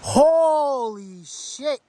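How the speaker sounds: high and drawn out very slowly, stunned; amazed exclamation. Real, not acted. Hiker seeing the view from the trail, stunned and slow. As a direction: let it stretch out in awe.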